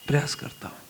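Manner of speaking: humble whispered reply. Retold or imitated. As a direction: breathe it out softly, humbly. (विनम्रता से फुसफुसाते हुए)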